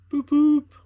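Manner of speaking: high, loud train toot; loud whistle. A narrator's reading. Ivor heading home, loud high double toot. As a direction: blast the whistle twice. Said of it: "went Ivor's whistle".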